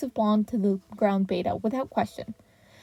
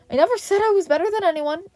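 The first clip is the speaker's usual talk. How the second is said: high, fast indignant protest; objecting; 10 semitones higher, 5 dB louder, faster than usual. A narrator's reading. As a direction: protest it, stung and defensive. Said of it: "All Might objected".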